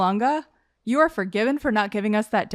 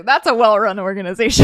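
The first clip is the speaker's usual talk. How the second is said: laughing; 8 dB louder than usual. Real, not acted. Sarcastic laughing jab at Fever.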